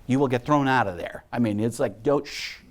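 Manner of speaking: ordinary talk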